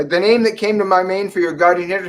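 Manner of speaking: ordinary talk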